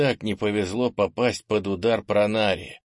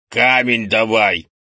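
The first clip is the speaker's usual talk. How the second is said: loud, slow bellow; impatient order; 9 dB louder, slower than usual. A narrator's reading. Grandfather repeats his order to the boy, impatient shout. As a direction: bellow the demand.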